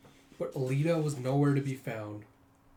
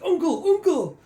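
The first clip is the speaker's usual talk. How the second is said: high, loud squeal of uncle; choked pupil crying uncle; begging in a headlock; 9 dB louder than usual. Retold or imitated. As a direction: yelp for mercy.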